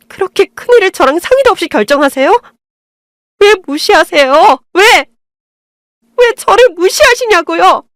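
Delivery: daughter-in-law confronting her mother-in-law, rising outrage; shouting back. Acted. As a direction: build from hurt to an outraged cry. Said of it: "소리를 지르며 달려들었어요".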